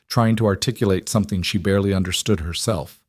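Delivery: ordinary delivery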